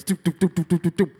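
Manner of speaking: quick little "doop" footsteps; imitated running noise. Friends running to the ride, imitated patter. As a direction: rattle off loud running sound effects. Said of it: "He's just running around a little".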